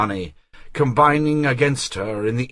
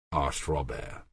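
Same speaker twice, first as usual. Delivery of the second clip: ordinary delivery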